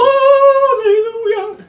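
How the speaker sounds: gasp, then cry hallelujah way up high and loud; joyous drawn-out cheer, rising high; ecstatic shout. Real, not acted. Friend overjoyed at the pick, crying out in delight. Gasps, overjoyed at Tron and The Thing.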